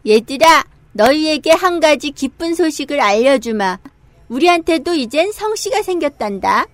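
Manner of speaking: joyful announcement. Acted. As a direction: call out the good news gladly. King announces news to monkeys. (기쁘게 크게 알리는 목소리)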